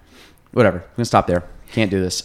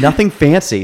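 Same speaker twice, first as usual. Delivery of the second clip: mock Angela correction; 6 semitones higher, 7 dB louder than usual. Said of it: "He's like".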